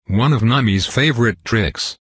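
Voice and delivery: ordinary delivery, nothing marked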